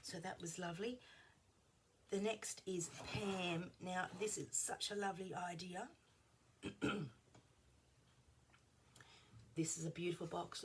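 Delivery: ordinary talk, nothing marked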